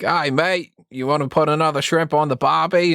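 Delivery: put-on Australian accent. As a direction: imitate someone doing a bad Australian impression. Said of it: "their impression of an Australian person".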